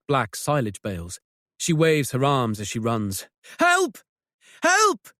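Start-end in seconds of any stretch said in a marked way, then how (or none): 3.6-5.1 high desperate yell; shouting for help. Acted. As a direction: scream for help across the field. Izzy runs screaming for help.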